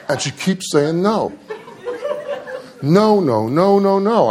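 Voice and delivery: laughing through the retold refusals; amused, laughing retelling.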